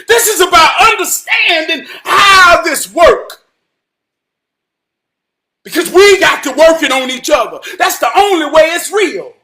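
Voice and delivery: loud, high, fervent proclamation; full-force preaching. Real, not acted. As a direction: drive each phrase home like a preacher.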